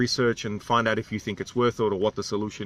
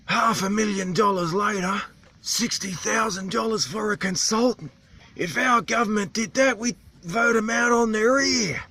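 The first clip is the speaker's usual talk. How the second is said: higher, strained local voice; islander's animated voice; 9 semitones higher than usual. Retold or imitated. Vlogger imitates islander ranting about vests and boots, strained high. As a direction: push the voice up like the animated old islander.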